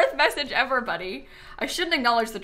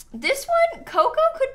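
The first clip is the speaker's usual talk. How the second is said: excited squeal; 13 semitones higher, 4 dB louder than usual. Real, not acted. Spotting a cute cat.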